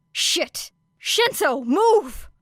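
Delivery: whispered curse rising to a loud high yell; alarmed shout. A narrator's reading. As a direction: scream a warning. Warning shout in danger.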